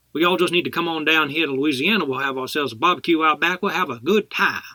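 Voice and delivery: hammed-up Southern Louisiana accent for an audition; New Orleans accent. Actor improvising a phone audition for a casting director, New Orleans accent. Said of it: "can you do a New Orleans accent".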